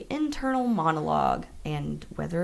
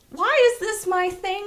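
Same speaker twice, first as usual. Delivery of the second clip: exasperated whine; 12 semitones higher, 4 dB louder than usual. Real, not acted. Exasperated protest.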